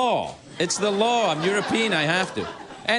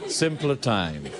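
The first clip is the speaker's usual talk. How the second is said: slow, lower and wistful; mock nostalgic sigh; 4 semitones lower, much slower than usual. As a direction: savour it like an old-timer.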